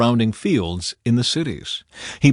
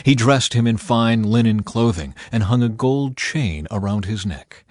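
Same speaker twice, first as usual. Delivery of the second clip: ordinary delivery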